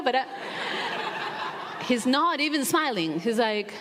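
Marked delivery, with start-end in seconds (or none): 0.3-1.8 a short airy laugh at her own joke; soft breathy laugh. Real, not acted. Speaker laughing at her own joke to the audience, soft breathy laugh. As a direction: let a quiet laugh slip out.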